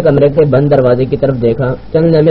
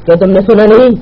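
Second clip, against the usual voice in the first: raised, higher-pitched demand; commanding; 6 semitones higher, 4 dB louder than usual. Acted. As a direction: order sharply through the door. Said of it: "اس بار تحکمانہ لہجے میں کہا گیا". (تحکمانہ لہجے میں)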